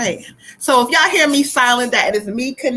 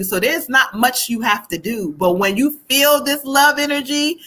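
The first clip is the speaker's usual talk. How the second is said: ordinary talk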